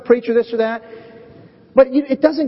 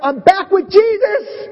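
high, loud, slow eager cry; mock fired-up Peter; 11 semitones higher, 5 dB louder, slower than usual. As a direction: burst with eager joy at Jesus. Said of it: "He's all fired up".

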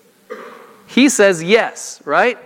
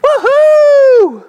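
mock cheer; 8 dB louder than usual. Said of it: "he would just be like".

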